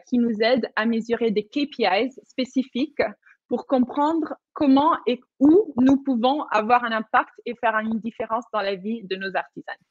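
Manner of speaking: normal talking voice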